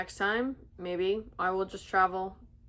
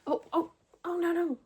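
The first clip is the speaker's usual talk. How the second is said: startled; 8 semitones higher than usual. Real, not acted. Bunny escaping in hands.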